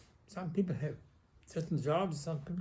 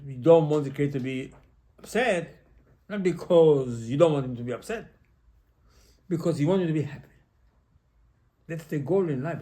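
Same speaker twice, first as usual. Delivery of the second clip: louder, driving the point home; urgent sermon emphasis; emphatic preaching; 7 dB louder than usual. Real, not acted. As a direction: press the message hard with rising force.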